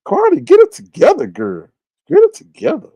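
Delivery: high, exasperated call-out; playful scolding. Acted. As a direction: call her out in a high, pleading mock scold. Calling out a scolding to Kwani.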